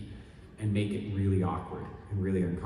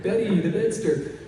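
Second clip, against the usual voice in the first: higher, slow cheesy sketch call; SNL sketch voice; 8 semitones higher, slower than usual. Retold or imitated. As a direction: ham it up like the sketch. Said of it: "the Saturday Night Live sketch".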